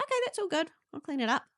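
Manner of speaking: high, light and carefree; easygoing Nick. Retold or imitated. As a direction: toss it off like nothing happened. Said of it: "Nick's gonna be like".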